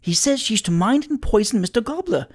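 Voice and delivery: high-pitched aghast woman's voice. A narrator's reading. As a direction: report it in high, shocked alarm. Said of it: "aghast at this sacrifice of human life".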